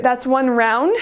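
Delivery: ordinary delivery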